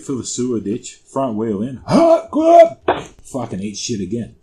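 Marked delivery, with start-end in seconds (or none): 1.9-2.8 high, loud impact noises; re-enacted gasp and thud; crash yelp. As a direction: yelp as you hit the ditch.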